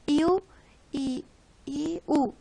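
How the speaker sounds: iu dicho más alto y fuerte, separando las vocales; diptongo iu. Shown as a demonstration. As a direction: marcar bien iu en cada vocal. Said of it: "solo existen en la lengua española dos casos". (diptongo iu pronunciado claramente)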